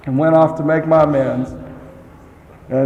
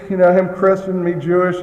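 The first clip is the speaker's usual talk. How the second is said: normal talking voice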